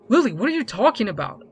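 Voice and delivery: confused. Acted. Cyrus defends, confused.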